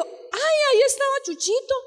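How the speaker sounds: high-pitched, fast, startled neighbour woman; a sudden high little cry of surprise from the woman; surprised old lady. Retold or imitated. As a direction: squeal it out like a flustered old lady. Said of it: "la señora lo miró y dijo".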